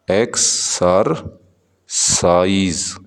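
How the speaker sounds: Exercise stretched slowly into three beats; slow syllable demo. Shown as a demonstration. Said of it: "exercise में कितने". ("Exercise" बहुत धीरे, तीन हिस्सों में)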